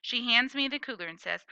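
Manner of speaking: usual speaking voice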